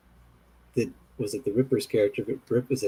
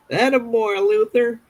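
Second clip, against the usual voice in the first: goofy character voice; 12 semitones higher than usual. Retold or imitated. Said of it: "they would be like".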